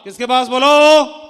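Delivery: loud, slow call-out to the audience; calling to crowd. Real, not acted. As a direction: draw the hall into answering. (धीमी, ज़ोरदार पुकार)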